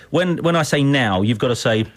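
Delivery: usual speaking voice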